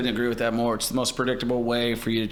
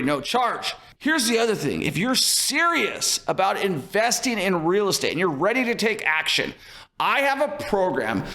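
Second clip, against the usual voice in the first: energetic, higher-pitched sales pitch; promo pitch; 6 semitones higher than usual. Acted. Host interrupts the podcast to pitch listeners, energetic promo read. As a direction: pitch it punchily, key words jumping high.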